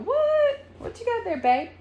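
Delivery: high, curious teasing voice; imitating Pate. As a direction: mimic him leaning in nosily. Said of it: "Pate's over here with".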